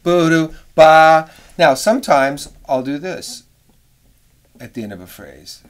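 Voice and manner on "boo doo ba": scatted, ba much louder; swelling sung figure; crescendo. Shown as a demonstration. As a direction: push the ba out. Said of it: "a little more expressive if they crescendo".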